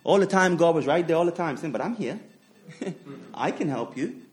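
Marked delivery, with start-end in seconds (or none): 1.7-4.2 soft and laughing, a gentle reassurance; God quietly offering help. Retold or imitated. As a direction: speak softly, smiling through it.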